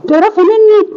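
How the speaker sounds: can't believe what she hears; hurt disbelief. Acted.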